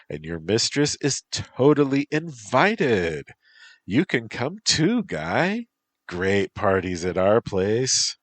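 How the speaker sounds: put-on high, teasing valley girl; bubbly valley girl teasing. Bee girl character speaks.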